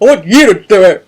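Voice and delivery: loud hammed-up insistence; mock picky demand. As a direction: demand it like someone emotional and picky. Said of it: "Getting all picky and shit".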